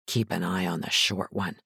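low, soft aside; quiet warning. A narrator's reading. Partner warns her about the fidgety thug, quietly. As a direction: murmur it so only she hears. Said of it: "Ryan said to her quietly".

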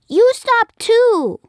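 loud, high, slow scold; child scolding. Acted. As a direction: wag a finger with your voice. Girl scolds the monster.

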